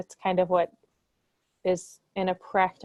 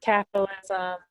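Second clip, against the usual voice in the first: bad connection. Real, not acted. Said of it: "you sound a little bit choppy now".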